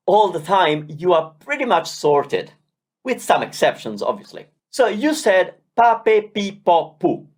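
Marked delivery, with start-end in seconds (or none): none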